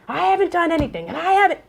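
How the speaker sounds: high, insistent mock-defensive customer; anxious caller. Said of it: "I hear the anxiety".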